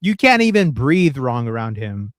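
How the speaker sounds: ordinary talk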